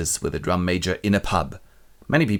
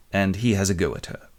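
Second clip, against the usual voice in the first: ordinary talk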